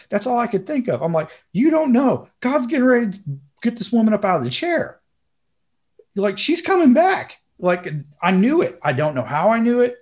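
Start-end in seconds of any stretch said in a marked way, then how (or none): 1.5-7.4 excited certainty. Retold or imitated. Acting out his excited certainty.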